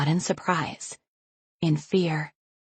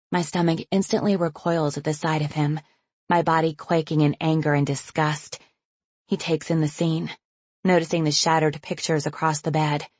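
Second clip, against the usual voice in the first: ordinary delivery, nothing marked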